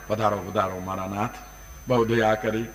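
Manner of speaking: low, soft, humble invitation; acted devotee's deferential greeting; reverent welcome. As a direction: usher in honoured guests with hushed devotion. Devotee ushers the holy guests in.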